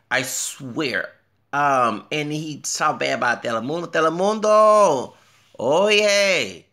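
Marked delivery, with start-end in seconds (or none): 3.9-6.6 loud, high, drawn-out call; showy exclamation. Pretend. Calling out the bashed network, loud and showy. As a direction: sing out the name like a big announcement.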